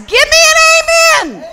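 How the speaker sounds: high, loud, drawn-out gospel call; call for amen. Real, not acted. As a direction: rouse the congregation to shout back. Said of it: "and I'm gonna ask".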